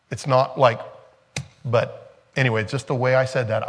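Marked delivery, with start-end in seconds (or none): none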